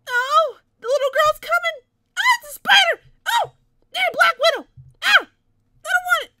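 high, loud panicked screams; screaming at the spider lady in the game; terrified screaming. Real, not acted. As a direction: shriek it out in real fright.

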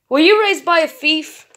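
loud accusing question; accusing shout. Acted. Owner confronts the robot.

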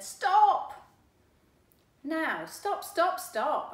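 high, loud commanding yell; shouting stop. Acted. Boy yells at the cat to stop, loud. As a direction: call out to halt the cat.

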